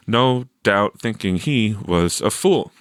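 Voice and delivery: usual speaking voice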